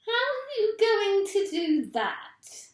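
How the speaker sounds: high, loud, slow nagging voice; shrill Missus Twit. A narrator's reading. Wife questioning Mister Twit's plan, high and loud. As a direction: needle him like a sharp-tongued wife. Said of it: "asked Missus Twit".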